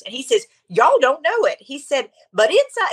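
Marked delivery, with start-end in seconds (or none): 0.7-1.6 high-pitched imitation of a pastor's accent; Jensen Franklin's accent. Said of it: "I'm trying to do his". 2.3-2.9 very high imitation of a preacher's accent; Jensen Franklin's accent. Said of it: "his accent".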